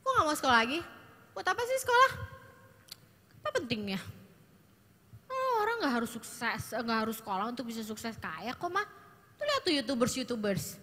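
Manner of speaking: a lightly voiced sulky teen; repeating the teen's lines. Shown as a demonstration. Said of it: "jadi mamah yang merespon si anak". (mengulang ucapan anak dengan ringan)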